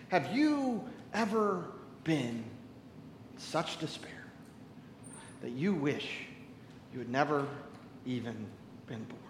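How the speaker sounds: soft, breathy sermon hush; hushed question. Acted. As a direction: pull them in with a hush. Hushed personal question to congregation.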